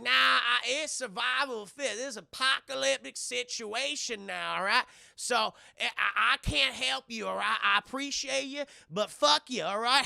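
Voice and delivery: hammed-up hillbilly robber, brushing them off; Southern twang, mock-reasonable then defiant; cowboy stranger. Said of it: "this guy in a cowboy hat".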